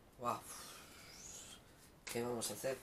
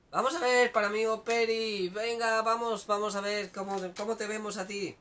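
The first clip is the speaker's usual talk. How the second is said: excited; 6 semitones higher, 6 dB louder than usual. Real, not acted. Entusiasmo al abrir otra lectura.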